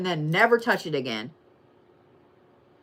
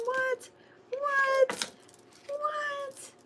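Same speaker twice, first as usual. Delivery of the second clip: high squeaks, each one higher; squeaky mock surprise; 7 dB quieter, much slower than usual. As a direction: squeak it in fake disbelief. Playful squeaks of disbelief.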